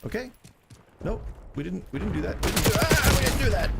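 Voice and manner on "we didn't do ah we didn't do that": high, loud panicky protest; flustered denial. Real, not acted. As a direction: blurt out a frantic denial. Flustered denial after in-game mistake.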